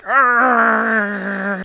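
loud, high frustrated yell of pure rage; frustrated roar. Real, not acted. Gamer fed up with the crashes, raging yell. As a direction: let out a furious wordless roar.

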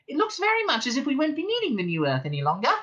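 high, quick mouse voice; Frankie the mouse, matter-of-fact. Acted. Frankie Mouse says the new Earth is unneeded, piping. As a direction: explain it in a high creature voice.

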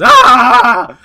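loud, high startled shriek; mock panic scream; scared scream. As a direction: yelp like you've just spotted the alligator. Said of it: "He goes".

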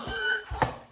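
high burst of real laughter; helpless laughter. Real, not acted. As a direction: crack up mid-story. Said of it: "it's so funny".

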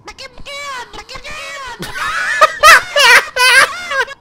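loud, high burst of laughter; shrieking laugh. Real, not acted. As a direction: cackle hard and loud. Laughs at the chicken.

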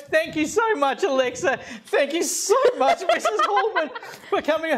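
high, still laughing while thanking everyone; bubbling over with real laughter; laughing sign-off. Real, not acted. Signs off after cake prank.

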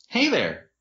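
usual speaking voice

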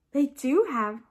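usual speaking voice

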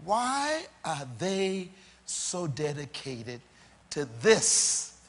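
slow, spaced-out question, landing on "this"; drawn-out, weighty delivery with pauses; deliberately slow. Shown as a demonstration. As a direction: say it slowly so every phrase sinks in. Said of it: "I'm gonna say it slow".

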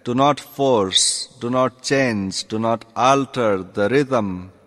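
ordinary talk

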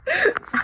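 high hiccup gasp; sharp high gasp of relief; relieved gasp. Real, not acted. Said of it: "I did it".

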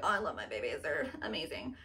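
low, soft doting voice; lightly voiced doting fish parents; tender parent. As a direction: coo over the babies. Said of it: "they're like".

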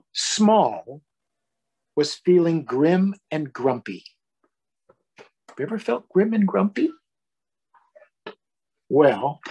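gentle storybook read-aloud voice; reading to children. A narrator's reading. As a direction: read it like a bedtime story for little ones. Reading picture book to children.

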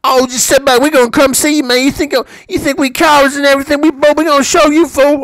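loud high mock threat from a gangbanger; mocking gang member. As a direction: mimic the tough-talking gangster, hammed up. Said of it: "to you gang members who are gonna be making your little comments".

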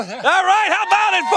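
high-pitched call to the crowd; rousing showman's call; emcee hype. Pretend. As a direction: whip up the audience like a host. Whipping up the crowd.

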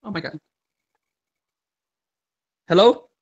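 ordinary talk

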